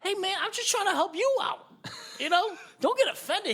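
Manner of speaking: high-pitched mock protest. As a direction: insist you meant well, voice climbing high. Retold pleading in an argument.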